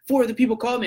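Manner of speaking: high-pitched imitation of an arguing customer; insistent protest. Angry customer argues that people called him, insistent.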